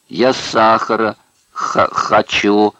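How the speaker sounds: loud, drawn-out whimper; whining. A narrator's reading. Prisoner begging fellow inmates for sugar, whining. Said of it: "захныкал Амерс".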